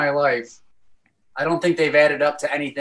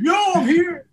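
high, playful mock greeting; imitating celebrity viewer; 13 semitones higher than usual. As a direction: pipe up high as the celebrity in chat. Imitating celebrity typing in chat.

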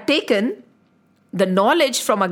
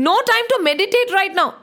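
irritated; 8 semitones higher, 4 dB louder than usual. Acted. Guru interrupted in meditation.

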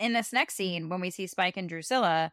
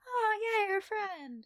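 naive acceptance; 10 semitones higher, 4 dB quieter than usual. Retold or imitated. Voicing a taken-in viewer's reaction.

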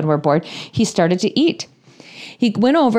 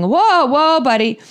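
higher, anxious calming of a horse; jittery higher whoa to a horse; nervous whoa; 6 semitones higher, slower than usual. Retold or imitated. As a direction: try to settle the pony while scared. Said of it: "I'm, like, going".

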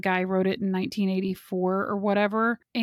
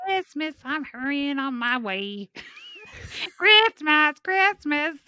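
lightly voiced grumpy elf, sung; 5 semitones higher, slower than usual. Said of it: "That was like a grumpy elf voice".

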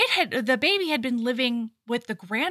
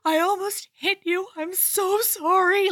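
higher voice, a mock horrified gasp of apology; 8 semitones higher than usual. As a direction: play her shocked at herself, apologising. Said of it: "she's like".

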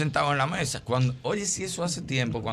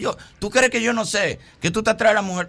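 mock angry man; 6 semitones higher than usual. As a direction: play the jealous guy. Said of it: "El tipo". (imitando a un celoso enojado)

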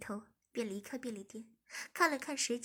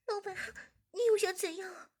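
higher, hurried apology; apologetic woman; 7 semitones higher, 4 dB louder than usual. A narrator's reading. Zhiming begs the boss, higher voice. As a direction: beg for another chance.

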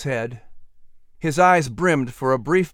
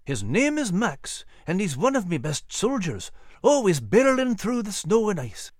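higher Scottish voice, proud and playful; grinning old Scottish sergeant; 7 semitones higher than usual. Acted. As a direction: boast fondly, Scottish, with a grin. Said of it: "answered with a grin".

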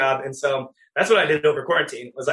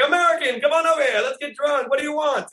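high, lively shout-out to a tourist; high-pitched party invitation; boisterous welcoming Italian; 9 semitones higher than usual. Retold or imitated. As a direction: imitate an overjoyed, friendly local. Voicing friendly Italians greeting Americans.

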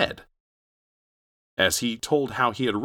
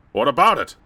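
gruff policeman; 5 semitones higher, 8 dB louder than usual. Acted. Cop dismissive.

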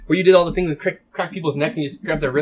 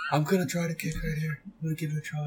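mock Steven Seagal; 3 semitones higher, 9 dB quieter than usual. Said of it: "imagine Steven Seagal trying to kick".

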